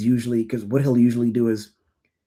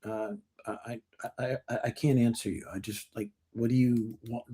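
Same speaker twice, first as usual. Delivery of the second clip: flustered mumble; 2 semitones lower, 10 dB quieter than usual. Retold or imitated. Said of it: "they'd be like".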